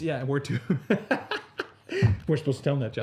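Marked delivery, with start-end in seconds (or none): 0.7-1.6 a short high burst of laughter. Real, not acted. Co-host laughs at the joke, a high laugh. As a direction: laugh at the two-subscriber joke.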